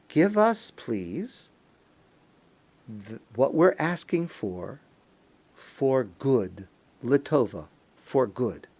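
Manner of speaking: polite plea, dropping low after the opening; measured request. Shown as a demonstration. Worshippers asking God for what is good, in a measured plea. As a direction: ask humbly, pausing between phrases. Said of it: "The words of the prayer are".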